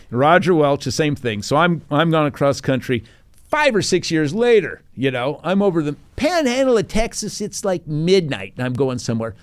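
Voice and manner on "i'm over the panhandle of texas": ordinary talk, nothing marked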